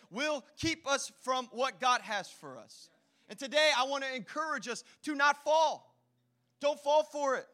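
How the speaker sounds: preaching. Acted. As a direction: preach it out to the congregation. Preaching at full force.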